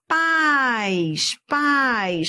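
PAIS stretched as one gliding syllable, twice; one long syllable. Shown as a demonstration. Teacher asking what pais contains, one long syllable. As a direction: hold the diphthong in pais without breaking it. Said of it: "não é país".

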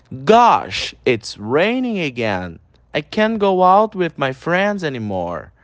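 slow, carefully spelled-out English; slow teacher's pronunciation demo; slow pronunciation model. Shown as a demonstration. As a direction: model each word slowly for students to repeat. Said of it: "repete comigo a pronúncia".

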